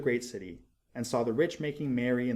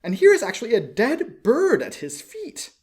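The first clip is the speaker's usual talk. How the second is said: much higher, louder put-on voice; the mayor spotting the dead bird; affronted mayor; 12 semitones higher, 10 dB louder than usual. A narrator's reading. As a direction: huff with fussy outrage. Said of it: "continued the mayor".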